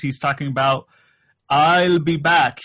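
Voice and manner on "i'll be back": slow, clipped-free mock Austrian "I'll be back."; lightly imitating an Austrian actor, drawn out; Arnold accent. Host quotes the famous catchphrase, slow mock Austrian accent. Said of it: "He can't pronounce".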